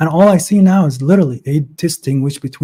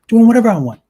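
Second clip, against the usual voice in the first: excited burst; 8 semitones higher, 7 dB louder, faster than usual. Real, not acted. Excited recall of freedom.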